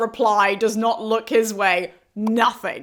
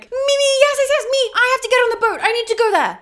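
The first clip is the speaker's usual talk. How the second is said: high, loud, frantic eagerness; desperate to be picked, high and loud; eager pleading; 14 semitones higher, 6 dB louder, faster than usual. Retold or imitated. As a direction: Dionysus begging Charon for a seat, high and frantic. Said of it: "Dionysus is like".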